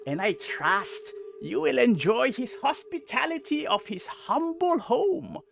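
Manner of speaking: high, loud, slow proclamation; gnome herald voice. Acted. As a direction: announce it like a pompous little herald. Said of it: "The gnome proclaims".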